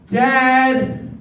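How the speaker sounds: put-on high, called-out little girl's voice from upstairs; little girl calling. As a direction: call up high like a small child from her bed.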